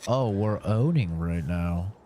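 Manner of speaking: usual speaking voice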